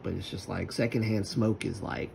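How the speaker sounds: ordinary delivery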